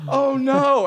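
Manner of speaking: high, loud mock dismay; imitated dismay. Young woman hearing train man turn, mock dismay. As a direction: play the naive woman suddenly alarmed. Imitated newcomer's shocked reaction.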